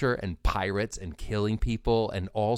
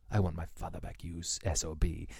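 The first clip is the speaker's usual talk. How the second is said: much softer, low, lethal; quiet grim vengeance; 6 semitones lower, 9 dB quieter than usual. Retold or imitated. Inigo to the Six-Fingered Man, quiet deadly menace. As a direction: say it low and cold over the dying man. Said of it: "Inigo Montoya goes".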